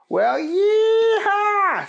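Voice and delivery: very high, loud, drawn-out cowboy whoop; yeehaw cheer. Acted. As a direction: whoop with joy over hot dogs. Keith cheers.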